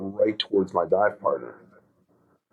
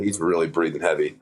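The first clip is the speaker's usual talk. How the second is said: normal talking voice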